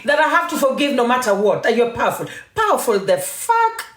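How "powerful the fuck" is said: high, slow disbelieving sneer; incredulous scoff. Real, not acted. Scoffing at a critic who calls herself powerful, incredulous. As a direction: drag the words out in mock disbelief.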